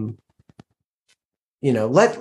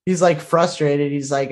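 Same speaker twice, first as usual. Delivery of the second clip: ordinary talk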